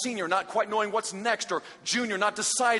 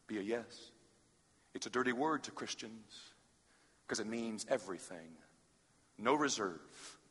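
soft altar call; 6 semitones lower, 6 dB quieter than usual. Acted. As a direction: draw them in quietly.

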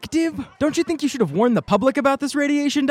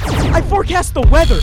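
ordinary delivery

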